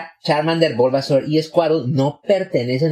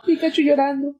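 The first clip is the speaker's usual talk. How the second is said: much higher, squeaky Pikachu whimper; squeaky Pikachu crying; 10 semitones higher than usual. Retold or imitated. Recalling the first movie's sad scene, mock Pikachu cry.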